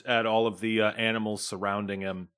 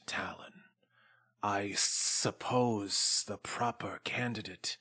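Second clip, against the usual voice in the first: slow, softer whisper; menacing whisper; 2 semitones lower, 8 dB quieter, slower than usual. Acted. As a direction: whisper it like a veiled threat. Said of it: "Trapjaw fixes his eyes on Talon and says".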